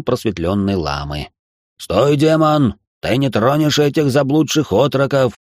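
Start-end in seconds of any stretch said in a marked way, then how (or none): 1.8-5.3 loud, declaiming stage voice; trained heroic voice. A narrator's reading. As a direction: boom it like a movie hero. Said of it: "хорошо поставленным голосом проговорил я".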